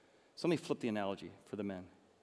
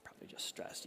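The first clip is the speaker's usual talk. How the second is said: whispered, much softer repeat; whispered aside; 9 dB quieter than usual. Retold or imitated. In-laws murmuring to each other, whispered aside. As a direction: drop it to a whisper.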